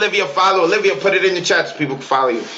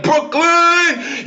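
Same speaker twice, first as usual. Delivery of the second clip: mock crowd shout; 12 semitones higher than usual. Imagined crowd member shouting out.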